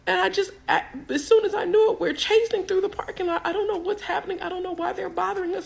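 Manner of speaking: very high mock-frightened whine; scared, tearful babble. Frightened passenger explaining the chase to police, scared and weepy. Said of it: "'Cause I was scared".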